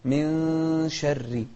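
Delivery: "من شر" with the nun held as a long nasal hum before the sheen; hidden nun. Shown as a demonstration. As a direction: stretch the nun of "من شر" through the nose, slowly. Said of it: "يعني نخفي النون ونقول". (إخفاء النون في "من شر" بغنة ممدودة)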